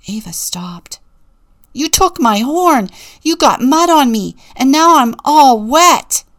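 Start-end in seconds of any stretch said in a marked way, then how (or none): 1.7-6.2 loud, higher, furious bellow; a loud angry roar of grievances. A narrator's reading. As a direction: bellow every complaint at her. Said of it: "bellowed Rufus".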